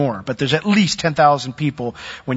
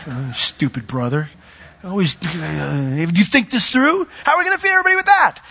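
scornful brother; 3 semitones higher than usual. Acted. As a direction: needle your little brother. Peter mocks his brother Andrew.

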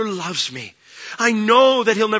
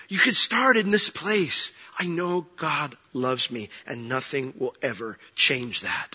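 quiet reassurance; 5 semitones lower, 5 dB quieter than usual. Real, not acted. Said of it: "softens to quiet reassurance".

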